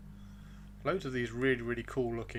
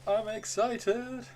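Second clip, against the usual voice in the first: slow excited; 7 semitones higher, much slower than usual. Real, not acted. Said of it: "Right, I'm excited".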